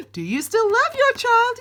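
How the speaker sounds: high, loud, taunting question; the universe needling a parent, high and loud; teasing universe. Pretend. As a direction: ask it high and loud like a cheeky cosmic voice. Universe voiced asking.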